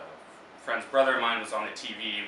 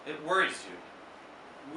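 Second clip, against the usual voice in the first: poetry reading; 4 semitones higher, 5 dB quieter, much slower than usual. Acted. Poem repeats the line.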